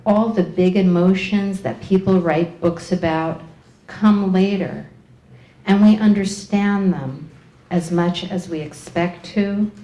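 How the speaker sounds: solemn verse with long pauses; poem reading. Acted. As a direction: deliver each line separately, falling low at the ends. Reads Julia's poem aloud.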